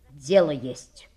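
low and stern, understated. A narrator's reading. Boy detective announcing business to his friend, mildly stern. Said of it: "строго сказал Шерлок Холмс".

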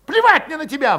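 high, loud furious cry; shouting back. A narrator's reading. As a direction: scream him down. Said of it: "выкрикнул Валет".

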